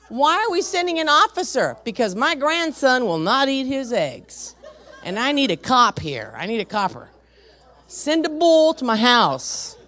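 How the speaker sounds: retold indignant phone tirade; exasperated demand. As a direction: demand help as an indignant grandma, hammed up. Acted demand to police dispatcher.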